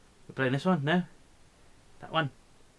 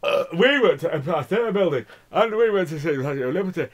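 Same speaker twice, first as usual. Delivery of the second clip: higher, louder prim tourist voice; mocking Barbara; 5 semitones higher, 6 dB louder than usual. Barbara boasting about her New York trip, high and prim. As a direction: play the chatty Yorkshire tourist. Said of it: "woman from Yorkshire called Barbara".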